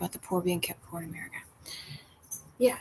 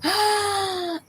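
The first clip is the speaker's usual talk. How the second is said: high shocked gasp; startled gasp; sharp gasp; 11 semitones higher, 4 dB louder than usual. Real, not acted. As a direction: gasp in horror. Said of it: "ladybug in eardrum".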